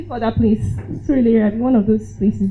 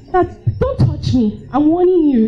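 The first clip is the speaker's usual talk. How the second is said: warning; 6 semitones higher, slower than usual. Retold or imitated. Warning attacker.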